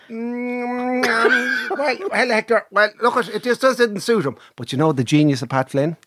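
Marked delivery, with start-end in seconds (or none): 0.1-4.3 high-pitched hemming and hawing; halting, stammered excuse, half laughing; evasive trainer. Retold or imitated. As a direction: imitate a trainer dodging the question. Acting the trainer's evasive reply.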